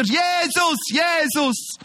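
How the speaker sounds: high, long-held cries of the name; screaming for help. Retold or imitated. Blind man refusing to be silenced by the disciples, crying out. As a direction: shout over those hushing you. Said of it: "die Seele aus dem Leib geschrien".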